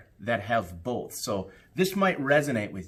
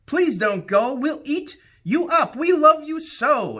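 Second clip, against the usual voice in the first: much higher, louder begging cry; pleading monsters; 7 dB louder than usual. Acted. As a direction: wail after him not to leave. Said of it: "the wild things cried".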